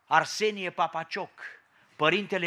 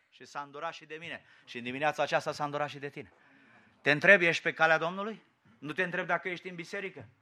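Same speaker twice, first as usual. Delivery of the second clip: ordinary talk, nothing marked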